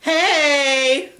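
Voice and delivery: high, loud singsong greeting; drawn-out, high-pitched call; cooing. Acted. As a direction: coo it out high and long. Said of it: "I coo".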